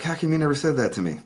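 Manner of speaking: [name]. Retold or imitated. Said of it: "he's like".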